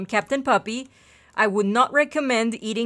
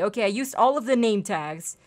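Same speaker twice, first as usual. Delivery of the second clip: ordinary delivery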